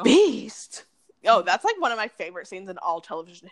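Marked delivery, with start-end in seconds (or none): 0.0-0.8 mock reality yell. Quotes the scene again.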